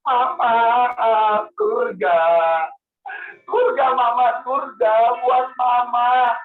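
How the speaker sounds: put-on crying child. Soleh offers heaven to his parents, crying. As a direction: sob it out slowly to Mama and Ayah. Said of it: "Soleh sambil nangis bilang gini".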